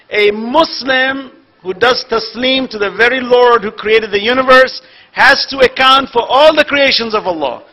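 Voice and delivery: loud, rising sermon delivery; preaching at full force. Acted. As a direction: drive the point home to the congregation.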